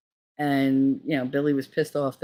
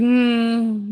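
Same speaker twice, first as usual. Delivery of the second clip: high, held mock hum; fake tight-lipped greeting; 7 semitones higher, 5 dB louder than usual. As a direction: hum as if pulling a snooty face. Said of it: "with the face".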